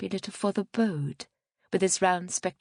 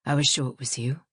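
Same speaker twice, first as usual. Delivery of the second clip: lower-pitched man's voice; Piers Longridge; 5 semitones lower than usual. A narrator's reading. Man greeting a woman he knows after church, lower-pitched. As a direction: play a man, low and even.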